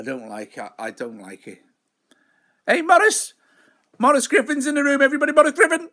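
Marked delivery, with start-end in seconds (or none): 2.7-5.9 high, loud rapid announcement to the room; excited shouted welcome. Real, not acted. Streamer hails a regular joining, excited high shout. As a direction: holler it out like a big arrival.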